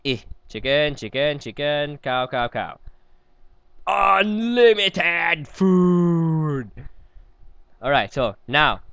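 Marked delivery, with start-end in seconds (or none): 3.9-6.7 very slow, stretched, higher proclamation; hammed-up victory shout; triumphant cry. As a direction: drag it out like a grand announcement. Triumphant game exclamation.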